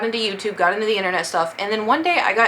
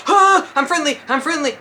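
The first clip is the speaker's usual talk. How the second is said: much higher, louder panicked I'm friendly; a mock-panicked, higher, louder I'm friendly; panicked plea; 7 semitones higher, 5 dB louder than usual. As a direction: yelp it out to avoid being shot. Panicking as a stranger approaches.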